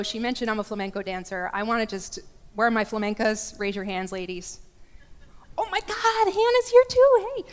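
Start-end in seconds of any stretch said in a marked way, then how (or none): 5.6-7.4 high, loud delighted squeal at a friend; light up at seeing her; excited surprise. Real, not acted. Speaker spots her friend Hannah in the audience, squealing with delight.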